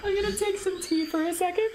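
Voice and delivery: high, giggle-strained voice; recovering from laughter. Real, not acted. Streamer telling herself to breathe, high voice still laughing. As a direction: try to catch your breath mid-giggle. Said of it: "she can't keep a straight face".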